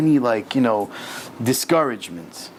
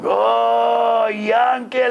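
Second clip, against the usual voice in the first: put-on high, loud hail to an old pal; boisterous drawn-out greeting, acting drunken soldiers; drunk Russian soldiers; 10 semitones higher, 3 dB louder, much slower than usual. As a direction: call out like rowdy drinking buddies. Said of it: "he's like Russian drunk soldiers".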